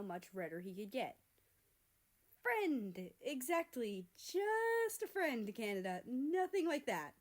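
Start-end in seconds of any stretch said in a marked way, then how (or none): 2.4-7.1 high, slow, loud protest; flustered insistence. Acted. America insists to Canada it's just a friend, high and flustered. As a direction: insist too hard, pitched up.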